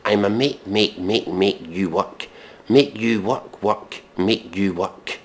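ordinary talk

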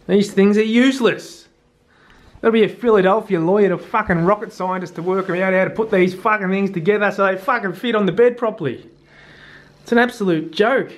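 high, fired-up complaint; a worked-up grumble that speeds up; exasperated rant. Real, not acted. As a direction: vent at the useless nets. Ranting at mosquito nets.

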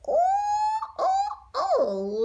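very high squealing ooh; mock shocked ooh. Teller reacting to the ruthless hunter's face, high mock ooh. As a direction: shriek it at the scary face.

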